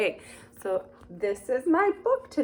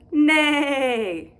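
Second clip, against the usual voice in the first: horse neigh; 4 semitones higher, 5 dB louder than usual. Acted. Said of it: "And this animal says".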